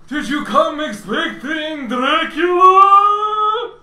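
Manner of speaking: put-on booming villain voice through old speakers; slow, high and loud, like a crackly PA; rumbling robot speaker voice. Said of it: "a rumbling voice ... sounds like it's coming from, from speakers ... really old speakers".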